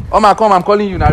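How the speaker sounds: loud, high urgent call. Real, not acted. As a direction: call her back insistently. Calling after a woman walking off.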